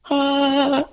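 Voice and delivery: high, sustained choir-like note; heavenly "ah". Retold or imitated. Imitating Oprah's moment of light as the door opens, heavenly "ah". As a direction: hold one angelic note for a mock revelation. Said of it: "it was like, you know, Oprah's".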